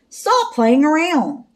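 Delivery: storybook telling-off, called across; calling out. A narrator's reading. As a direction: call out a drawn-out order. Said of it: "Velma called".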